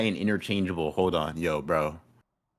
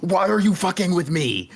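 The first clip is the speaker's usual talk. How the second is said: louder, higher exasperated yell; exasperated shout; 11 semitones higher, 5 dB louder than usual. Real, not acted. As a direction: shout it in disbelief. Repeated, louder outburst.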